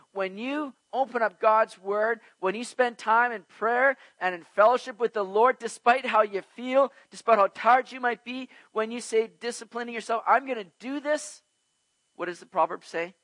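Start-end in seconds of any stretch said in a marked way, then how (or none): 0.1-11.4 higher, louder, building exhortation; rising preaching. Acted. Preacher urging the church to pray, building force. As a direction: drive it home to the congregation.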